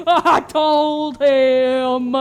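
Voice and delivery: words squeezed out through laughter; laughing. Real, not acted. Player laughing at Bill's accident, words through laughter. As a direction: laugh it out slowly.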